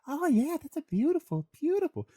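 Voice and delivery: put-on very high squealing girlfriend voice, drawing out beautiful; mock a girlfriend gushing over a prize; girly falsetto. Girlfriend tugging her man's arm at the booth, squealing falsetto. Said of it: "I shouldn't use that voice".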